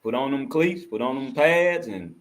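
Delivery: ordinary talk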